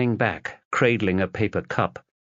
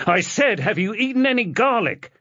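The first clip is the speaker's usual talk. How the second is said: high, pressing repeat; insistent; 13 semitones higher than usual. Acted. Husband pressing his wife again, high and insistent. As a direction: demand the answer now.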